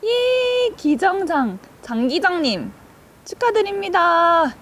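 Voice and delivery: high cheer, slow congratulations; hyped giveaway announcement. Real, not acted. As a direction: announce the winner with hype. Announcing fourth winner.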